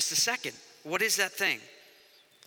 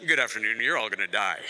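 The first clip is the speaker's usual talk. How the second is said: very low, unruffled pilot drone; deadpan pilot announcement; 10 semitones lower than usual. Acted. Pilot telling passengers they're all gonna die, deep deadpan monotone. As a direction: deliver doom like a routine intercom greeting. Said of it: "if a pilot gets on and he's like".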